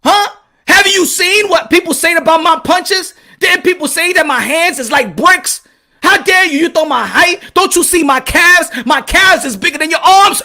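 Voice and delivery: acted indignant challenge, pitch jumping up; Manny Pacquiao outraged. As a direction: scold the rival like an insulted champion. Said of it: "I'm speaking for Manny Pacquiao right now".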